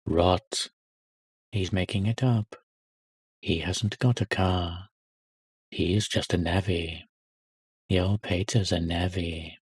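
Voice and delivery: a hint of sneering schoolyard taunts; mocking schoolboys. Boys mocking the little boy.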